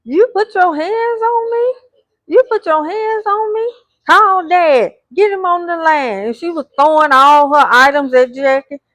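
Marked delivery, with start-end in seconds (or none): 0.0-6.2 retold yelling. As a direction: shriek it like you've just been shoved. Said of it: "Chantel is yelling".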